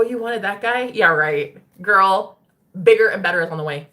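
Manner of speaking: ordinary delivery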